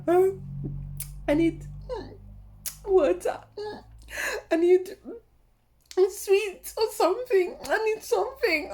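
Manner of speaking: high, faint, pleading moan; breathless whimper. Retold or imitated. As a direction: beg for water after the climb, out of breath. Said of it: "I was like".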